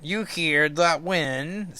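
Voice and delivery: high and slow, drawn out; Sim, high, slow and wary. Acted. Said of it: "Sim says".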